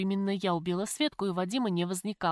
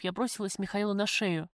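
plain reading voice

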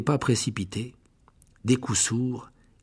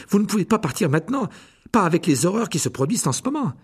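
louder, raised protest at the teacher; indignant protest; 6 semitones higher, 5 dB louder than usual. Acted. Harry protests to Lockhart, raised and indignant. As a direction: push back hard, outraged.

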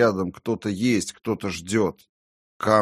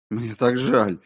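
higher-pitched cry of remorse; pained, raised apology; anguished apology; 4 semitones higher, 3 dB louder than usual. A narrator's reading. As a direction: plead how sorry you are. Remorse under accusation.

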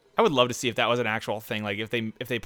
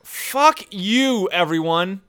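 angry shout; 7 semitones higher, 4 dB louder, much slower than usual. Real, not acted. Frustrated at racing rivals.

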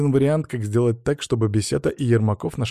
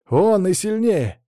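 high, stretched-out merry call; amused exclamation; 9 semitones higher, 4 dB louder, slower than usual. A narrator's reading. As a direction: throw it out as if about to laugh. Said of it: "засмеялся я". (весело, нараспев и высоко)

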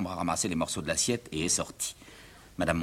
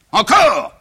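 high, loud exasperated father; outraged scolding; 12 dB louder than usual. Acted. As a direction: shout the questions in disbelief. Father scolds over broken plate.

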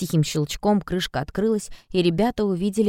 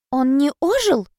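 high, swooping question of disappointment; mildly crestfallen, high-pitched query; disappointed question; 9 semitones higher, 4 dB louder, slower than usual. A narrator's reading. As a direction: ask it let down, pitch raised. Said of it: "разочарованно спросила Ода".

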